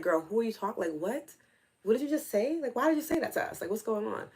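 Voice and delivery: mock disbelief, voice lifting on the questions; incredulous scolding. Aunt questioning her niece's talk, incredulous. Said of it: "where we're just like".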